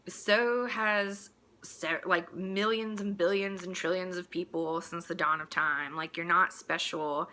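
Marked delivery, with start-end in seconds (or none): none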